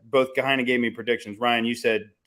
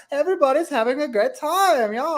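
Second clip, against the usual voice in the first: much higher, hammed-up chirpy American host; 14 semitones higher than usual. Mocking how foreigners see America, hammed-up high cheery voice. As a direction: play an over-the-top cheerful American. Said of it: "It's either".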